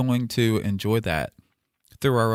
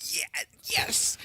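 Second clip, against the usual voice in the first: whisper; 9 dB quieter than usual. Real, not acted. Stifling excitement as poem announced.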